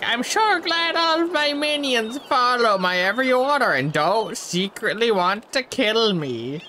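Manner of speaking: high, drawn-out hammy villain voice; put-on villain boss. Player as boss addressing minions, hammed-up villainy. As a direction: ham it up high and slow. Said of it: "I'm up here like".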